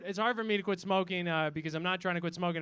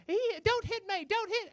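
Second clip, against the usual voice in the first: very high mock child's plea; pleading child; 13 semitones higher than usual. Child begging not to be hit, high mock plea. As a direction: squeal it like a kid dodging a smack. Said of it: "he would be going".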